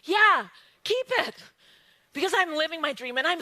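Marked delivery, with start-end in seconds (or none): 0.0-1.3 carefree, generous dismissal; breezy keep the change. Pretend. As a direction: wave the money off grandly. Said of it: "a vision of yourself that's like".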